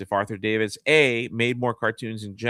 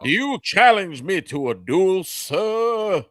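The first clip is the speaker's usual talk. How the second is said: put-on high, loud Southern accent that flips up into falsetto on sir; Southern colonel demanding a duel in falsetto; falsetto Southern gentleman; 8 semitones higher, 5 dB louder, slower than usual. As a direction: challenge him to a duel, Southern style, in falsetto. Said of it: "A fa-, a falsetto".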